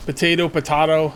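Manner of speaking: potato first as puh-TAY-toh, then potato as puh-TAH-toh; both pronunciations. Shown as a demonstration. Player comparing accepted variants, potato as TAY then TAH. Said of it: "I'll just say both".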